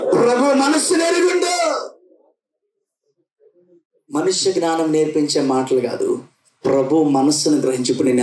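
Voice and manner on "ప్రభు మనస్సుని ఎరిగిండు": high, ringing shout of the phrase; say it loud. Shown as a demonstration. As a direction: belt it out for the whole church. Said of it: "మెల్లిగా కాదు, వాళ్ళకి అర్థమయ్యేటట్టు చెప్పండి".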